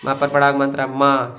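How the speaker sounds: normal talking voice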